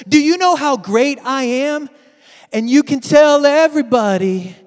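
a hint of a show-off king; boastful king. Preacher playing the king boasting on his palace roof, mock brag.

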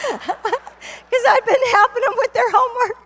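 high, loud, through real laughter; laughing. Real, not acted. Speaker explains the girls' Southern accent, laughing. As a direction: laugh your way through the punchline. Laughs at her own joke.

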